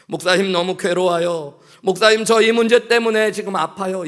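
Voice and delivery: rising to a loud, high desperate plea; pleading cry. Retold or imitated. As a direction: cry out to the pastor, building. Pastor voices a congregant's plea.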